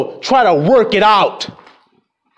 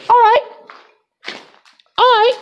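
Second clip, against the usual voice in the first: much higher mock brave. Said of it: "You have to just say".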